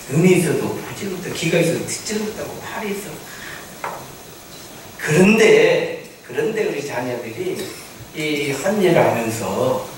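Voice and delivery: ordinary delivery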